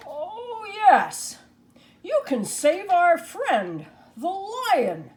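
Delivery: a scarecrow who just thought of something; eager scarecrow. Acted. Scarecrow eagerly offers idea.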